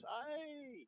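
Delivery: very high mock shriek; imitated scream. Imitating the daughter screaming as she falls down the steps, high shriek. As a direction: yelp as she tumbles. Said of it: "She goes".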